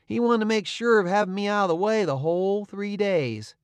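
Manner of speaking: ordinary delivery, nothing marked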